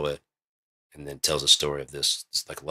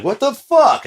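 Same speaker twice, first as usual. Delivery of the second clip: very high, loud shriek of disbelief; shrill incredulous yell; freaked-out shock; 12 dB louder, slower than usual. Acted. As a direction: act a freaked-out viewer. Voices freaked-out audience.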